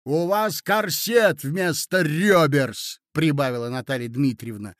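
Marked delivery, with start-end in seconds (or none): 0.0-3.0 slow, loud, raised jeer; spiteful taunt. Acted. Lady mocking the prince's false figure, spiteful. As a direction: throw the insult slowly and pointedly. Lady adds another insult.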